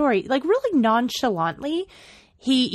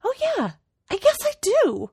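lightly voiced offhand reply, higher; mock casual, high and light; casual shrug; 13 semitones higher than usual. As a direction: toss it off like it's nothing. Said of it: "completely casually".

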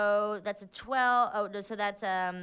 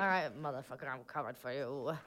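low, soft menacing threat; quiet threat; 9 semitones lower, 9 dB quieter than usual. Acted. Player warns the monster on her back, quiet threat. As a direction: threaten under your breath, pitched well down.

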